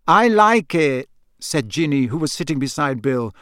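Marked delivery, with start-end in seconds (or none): 0.1-1.1 put-on higher, slow girl's voice; a teenage daughter siding with her brother; young girl. Ginny voiced in story.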